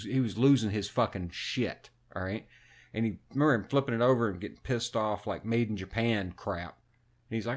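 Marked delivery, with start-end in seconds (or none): none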